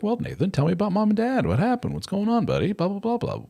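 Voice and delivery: high mock-therapist voice; hammed-up high therapist voice; therapist impression. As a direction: play the chummy therapist up high. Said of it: "what they'll do is be like".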